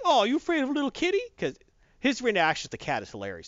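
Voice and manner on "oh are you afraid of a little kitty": put-on high, fast teasing baby talk; mocking Samuel Jackson. Jackson mocks the Skrull, high fast baby talk. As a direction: tease the alien like cooing at a pet. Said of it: "Samuel Jackson's like".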